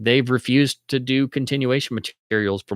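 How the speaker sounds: ordinary delivery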